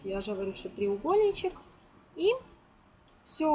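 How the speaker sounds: usual speaking voice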